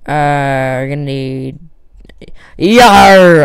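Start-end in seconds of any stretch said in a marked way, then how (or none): 2.6-3.4 loud pirate yar. Pretend. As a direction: growl it like a pirate. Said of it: "I sound like a pirate".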